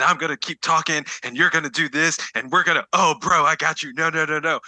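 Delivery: high, run-on impression of an excited chatterer; jittery excitement. Co-host mimicking Izzy who keeps talking, jittery excitement. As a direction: gabble on high, no room for interruption. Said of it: "she couldn't slow down, and everything was so excited".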